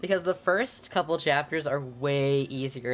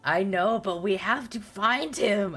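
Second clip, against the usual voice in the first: high, loud know-it-all tone; smart-aleck; 7 semitones higher, 4 dB louder than usual. Acted. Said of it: "I said smartly".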